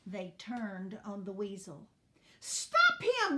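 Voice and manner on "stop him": high, loud shouted command. A narrator's reading. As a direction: shout it out high to stop an intruder. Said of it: "shouted Dot".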